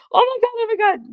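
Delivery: high, quick imitated panic; a squeaky-high rush of alarm; panicked. Son running out to find fallen mother, imitated panic.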